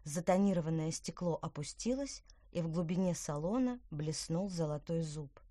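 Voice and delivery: plain reading voice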